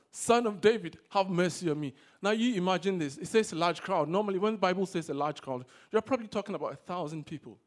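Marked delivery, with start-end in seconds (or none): 0.1-1.9 loud, insistent plea; projected cry for mercy; shouting all the more. Acted. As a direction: call out louder over those hushing you. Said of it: "but he shouted all the more".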